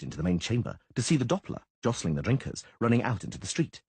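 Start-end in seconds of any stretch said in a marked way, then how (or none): none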